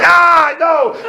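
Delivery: high, loud comic panic cry; acted panic. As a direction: yelp it as if the plane is dropping. Acts panic in falling plane.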